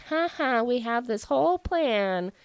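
lightly mocked villain gloating; smug. Two kings gloating over their whole plan, mock smug. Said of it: "is just kind of smug".

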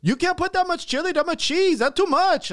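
loud, high imitated Indian accent. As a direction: scold like a clerk, in an Indian accent. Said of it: "that's how he sounded".

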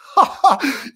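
high loud laugh; burst of laughter. Real, not acted. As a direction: crack up laughing. Laughing at brand name.

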